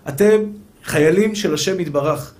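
usual speaking voice

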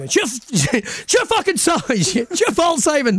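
high-pitched mock rant; ranting yell. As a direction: rant it out, half laughing. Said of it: "I went on some random rant one night".

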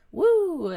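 high loud woo; excited whoop. Retold or imitated. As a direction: let out a playful whoop. Mimicking kids being loud.

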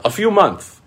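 very high, clipped mock answer; squeaky mock reply. Imitating the scammer's curt answer, very high. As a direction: toss it off in a tiny high voice.

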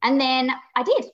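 usual speaking voice

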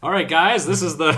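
put-on rally the team in a Mid-Atlantic accent. [name] as football coach rallying players, Mid-Atlantic accent. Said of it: "I always go with the Mid-Atlantic".

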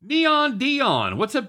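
high, loud hype call; hype announcer. Acted. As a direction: shout it like a hype man. Hyped greeting of Deion.

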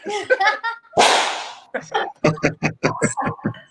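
high burst of laughter; hearty laugh. Real, not acted. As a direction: laugh out loud at the punchline. Laughing at own story.

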